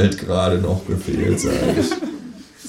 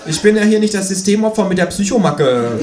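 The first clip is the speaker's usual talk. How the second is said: high, louder sulky grumble; grumbling kangaroo; 11 semitones higher, 5 dB louder than usual. A narrator's reading. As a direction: sulk it out as the kangaroo.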